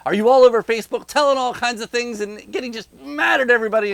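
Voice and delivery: fast, high, loud riled-up rant; breathless mock fury at Facebook posters; worked up. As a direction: whip up the outrage, rattling it off. Mocking angry Republican voter.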